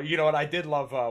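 ordinary delivery

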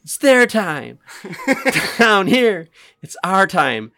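high, loud, slow dramatic speech; Goonies quote. Retold or imitated. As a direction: deliver it like a rousing kid hero. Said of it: "all right, ready?".

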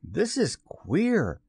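slow, high-pitched bewilderment; understated high gasp of wonder; gasped astonishment. A narrator's reading. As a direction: draw it out high, a little amazed. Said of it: "Smee gasped".

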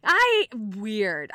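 ordinary talk, nothing marked

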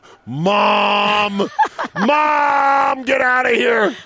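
high, drawn-out mock wail at mom; embarrassed kid. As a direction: shout it like a mortified child shooing mom away. Plays her embarrassed kid.

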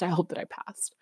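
hushed whispered repeat. Retold or imitated. Nervous student after the test, whispering to herself. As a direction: breathe it out as a nervous prayer.

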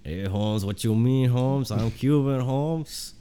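hammed-up Holmes at every line end. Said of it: "My dad's Cuban".

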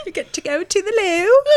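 high, squeaky fake English; mock English accent. Co-host piling onto the slang bit, mock English accent. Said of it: "got an annoyingly English accent back".

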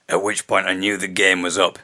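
ordinary talk, nothing marked

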